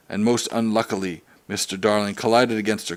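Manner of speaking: ordinary delivery, nothing marked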